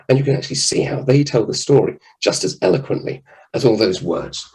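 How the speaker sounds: ordinary talk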